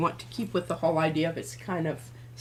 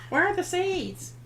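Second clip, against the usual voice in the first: put-on nagging kid; 10 semitones higher than usual. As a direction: pester like a nagging child. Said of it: "You kept nagging me about my seeds".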